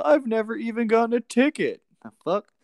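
usual speaking voice

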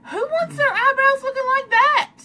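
high-pitched, dismayed wail; appalled protest. Real, not acted. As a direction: wail the question in disbelief. Objects to her painted eyebrows.

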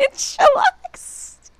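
high, loud mimic of Hao; squealed mimic. Imitating Hao's new word.

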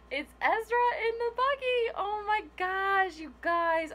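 thrilled. Real, not acted. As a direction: burst out with delight at the gift. Finally sees the wanted toy.

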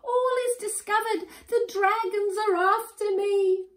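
very high panicked wail; guilty scream. A narrator's reading. Robber thinks the dragons are after him, high panicked scream. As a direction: cry out in terror like a robber found out. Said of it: "screamed the robber in a guilty voice".

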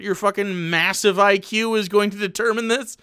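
ordinary talk

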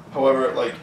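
ordinary talk, nothing marked

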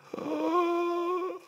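high sharp gasp; high astonished gasp; shocked gasp. Acted. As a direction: gasp at the screen. Shock at seeing the balance.

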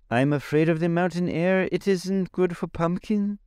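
high, moaning pumpkin-head voice. A narrator's reading. Jack wishing they never left the palace, high moan. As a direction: whine it like a frightened pumpkin. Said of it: "moaned Jack".

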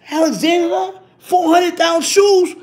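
high, stunned question about the price; shocked disbelief. Retold or imitated. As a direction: gasp at a four hundred dollar price tag.

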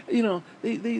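normal talking voice

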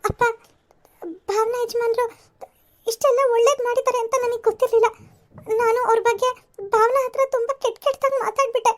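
crying to her father over harsh words; remorseful crying. Acted. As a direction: break down while admitting the fault. Remorseful daughter confesses to father.

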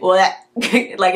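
ordinary delivery

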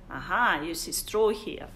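ordinary delivery

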